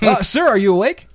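cheery clerk. Retold or imitated. Clerk prodding sleepy guest in perky voice. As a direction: perk it up.

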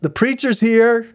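high, loud cop's announcement; cop calling out. Retold or imitated. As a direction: call it out to the back office like a desk cop. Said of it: "he just goes".

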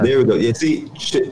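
ordinary talk, nothing marked

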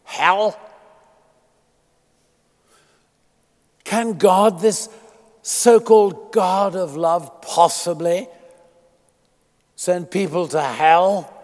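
fervent pulpit question; preaching at full force. Acted. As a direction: challenge the congregation. Rhetorical challenge.

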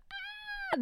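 very high, thin imitated baby scream; mock baby shriek. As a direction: pitch it way up like a shrieking infant. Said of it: "tjugofem såna skrik på rad".

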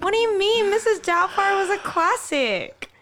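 high-pitched protest; playful indignation. Real, not acted. Co-host defending Missus Doubtfire as a classic, playfully indignant. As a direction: defend it in amused outrage. Mock outrage defending a film.

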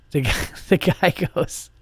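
laughing. Real, not acted. Laughing while retelling.

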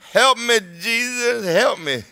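high, drawn-out wail for help; hammed-up begging cry to Jesus; mock desperate plea. Mimics a whining, pleading believer.

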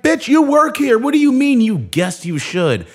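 yelling in disbelief at the clueless teller; exasperated yell. Retold or imitated. Yelling at the bank teller who works there, shrill disbelief. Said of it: "I'm like".

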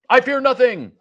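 high, loud alien boast; booming alien voice. Acted. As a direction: shout it like an arrogant alien. Gorgon denies fear.

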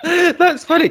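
bursting into amused laughter on the words; real laugh rising up. Real, not acted. Amused by the answer.

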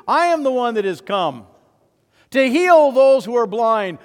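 Jesus declares himself Messiah in the synagogue; Jesus proclaiming. Retold or imitated. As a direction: thunder out the claim to be Messiah. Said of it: "And he said".

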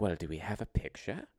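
soft mock British lady; imitating a hushed British granny; old British woman's accent. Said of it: "an old British woman's accent".

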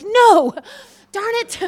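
high, loud no, falling off; playfully disappointed groan; mock dismay at losing the ministry.

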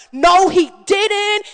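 sassy outraged wife; angry retort. Retold or imitated. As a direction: drag it out in disbelief and fury. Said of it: "I was angry".

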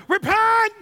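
sudden high shout of the word; preacher's sudden fiery cry; shouted command. Acted. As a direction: bellow it like a street preacher's call.

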